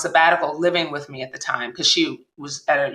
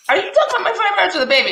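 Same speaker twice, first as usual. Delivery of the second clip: laughing disbelief; 14 semitones higher, 3 dB louder, faster than usual. Retold or imitated. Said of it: "And I was like".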